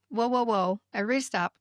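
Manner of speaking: usual speaking voice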